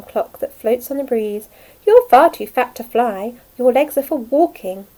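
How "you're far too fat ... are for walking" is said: loud, bossy sparrow. Acted. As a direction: tell him off firmly. Sparrow scolding caterpillar.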